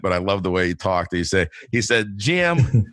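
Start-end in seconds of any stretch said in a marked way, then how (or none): 2.2-2.8 name called out in a fond imitation of Nelson Nash's accent; an affectionate, lightly done Nelson Nash accent. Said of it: "I hate to do his accent".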